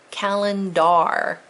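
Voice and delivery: "Calendar" slowly, ending in a full "dar"; spelling pronunciation. Shown as a demonstration. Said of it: "I had to say calendar".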